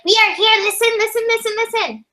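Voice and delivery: high, repeated mock pleading; insistent. Guides begging people to listen, insistent.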